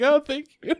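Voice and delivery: very high, over-polite thanks; polite cover-up. Retold or imitated. Resident came in during the joke, polite cover-up. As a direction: put on a sweet front for a visitor.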